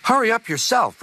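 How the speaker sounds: cheeky high-pitched retort; Thomas. A narrator's reading. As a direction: throw the order back in a high voice. Thomas retorts to Gordon's order.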